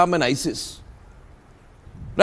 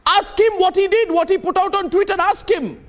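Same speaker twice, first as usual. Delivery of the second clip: high-pitched, insistent demand; heated accusation; 7 semitones higher, slower than usual. Real, not acted. Host demanding answers about a guest's tweet, heated and high-pitched. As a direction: press the charge, pitch raised.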